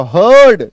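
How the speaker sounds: "हर्ड" loud, stretched on the er vowel; demonstrated pronunciation. Shown as a demonstration. Teacher points to the written pronunciation, loud slow "हर्ड" with long er. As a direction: call out "हर्ड" slowly, long er into d. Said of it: "देखो उच्चारण लिखा".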